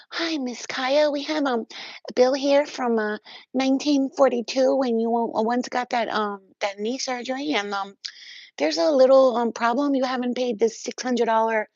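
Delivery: high, loud, fake-polite caller voice; lightly mocking, high-pitched collections agent; bill collector voice. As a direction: play a sugary billing clerk on the phone.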